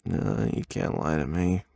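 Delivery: usual speaking voice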